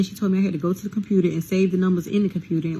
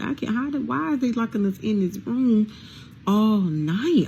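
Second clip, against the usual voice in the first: higher-pitched, incredulous retold question; exasperated disbelief; 4 semitones higher than usual. Inmate recalling being locked in all night, exasperated. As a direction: protest it in rising disbelief.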